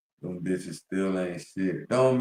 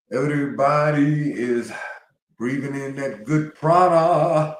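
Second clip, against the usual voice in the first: excited proclamation; 6 semitones higher, 6 dB louder than usual. Real, not acted. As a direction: preach it with uplift. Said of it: "hyped spiritual proclamation".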